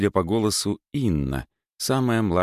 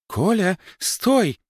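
shout; 12 semitones higher, much slower than usual. Acted. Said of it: "крикнула Анна Игнатьевна".

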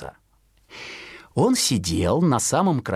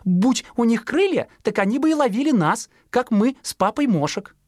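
much higher chirpy mother; high bird-mother voice; mother sparrow; 11 semitones higher than usual. Acted. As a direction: warn high like a sparrow mum. Mother explains.

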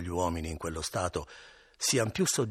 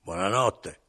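louder, gruff goodnight; curt goodnight; 3 semitones higher, 8 dB louder, slower than usual. Acted. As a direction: brush him off rudely. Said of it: "gli disse sgarbatamente".

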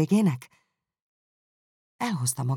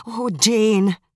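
high, louder cry of a name; 6 semitones higher, 6 dB louder than usual. Acted. Elizabeth cries out to Jane in distress. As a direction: call out to her sister in dismay. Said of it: "kiáltotta Elizabeth".

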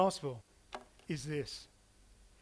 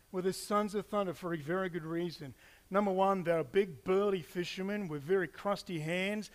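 ordinary talk, nothing marked

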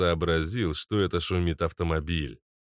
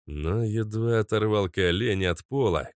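usual speaking voice

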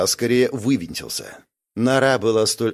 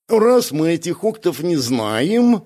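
slow, higher, scheming drawl; drawn-out; 5 semitones higher, 3 dB louder, slower than usual. A narrator's reading. Бяка eyeing an unclaimed prize, drawling. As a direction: stretch the words out slowly. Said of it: "протянул Бяка".

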